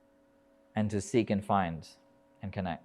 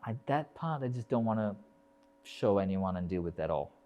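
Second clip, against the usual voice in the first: quiet inner voice; 8 dB quieter than usual. Retold or imitated. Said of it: "the parts that we think".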